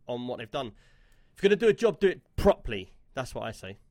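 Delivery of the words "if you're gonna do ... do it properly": emphatic. Real, not acted. Emphatic rant about bad ports.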